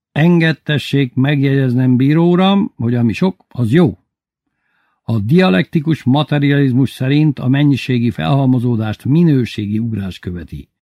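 a drunk man toasting with mock solemnity; drunk Bubu. (magasabb, hangosabb, ittas szónoklat)

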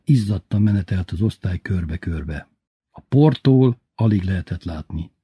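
ordinary delivery, nothing marked

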